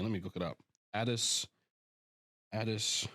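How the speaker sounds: normal talking voice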